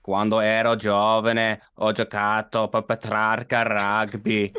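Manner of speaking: put-on slow, deliberate northern Italian accent; Veneto Italian accent. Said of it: "I've nailed my Veneto Italian accent".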